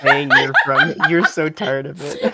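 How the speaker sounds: high, laughing through the words; giggling while she talks. Real, not acted. Laughing at friend's story.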